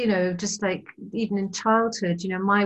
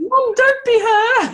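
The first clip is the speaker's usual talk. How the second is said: put-on kids pleading; 9 dB louder, slower than usual. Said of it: "they'd be like".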